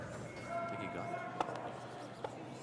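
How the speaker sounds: slow hushed whisper. Real, not acted. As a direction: whisper it after the shot. Commentator whispers after shot.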